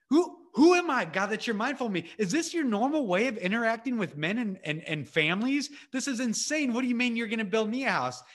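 high-pitched, swooping disbelief; astonished disbelief. Acted. David reacts to God's promise, astonished. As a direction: question it as if overwhelmed.

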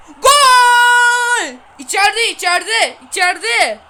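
loud, high goal yell then excited cheering; goal shout. Real, not acted. Kid celebrating his goal to viewers, shouting. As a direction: roar the goal and keep cheering.

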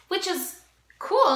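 high, loud and drawn out; excited. Real, not acted. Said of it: "enthusiastic emphasis".

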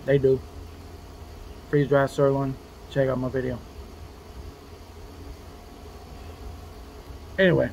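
talking with food in mouth; speaking between bites; chewing food. Real, not acted. Seen 2: Eats ration bar before, chews between phrases, keeps chewing after.

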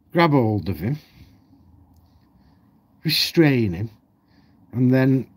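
ordinary talk, nothing marked

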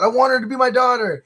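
retold heart-on-sleeve confession; imitated heartfelt plea. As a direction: plead it like a hopeful dad, lightly hammed-up. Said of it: "pouring his heart out".

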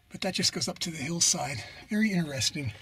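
ordinary talk, nothing marked

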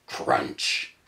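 crunch sound. Acted. Crash in the story.

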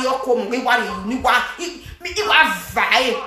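ordinary talk, nothing marked

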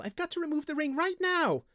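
loud, very high, desperate cry; wailing. A narrator's reading. Windling desperate to remove the ring, wailing. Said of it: "Linthus wailed".

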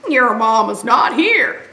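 a hint of a braying donkey; light donkey voice. Donkey telling piglet, lightly voiced. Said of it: "said Donkey".

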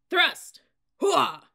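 high, explosive command and cry; shouted battle cry. A narrator's reading. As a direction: yell it as you lunge.

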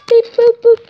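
phone beeps. Pretend. Hang-up noise.

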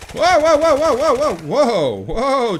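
loud, high string of whoas; alarmed. Real, not acted. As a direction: cry out in sudden alarm. Alarmed reaction in the game.

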